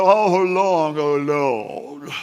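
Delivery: a lightly voiced, overly holy lament; pious sigh. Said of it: "You don't think he was like".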